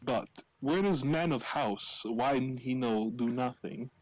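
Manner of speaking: indignant Transylvanian in broken English; comic Transylvanian accent; Vladislav's Transylvanian accent. Acted. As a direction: address the host in a mock Transylvanian accent. Plays Vladislav addressing the meat woman.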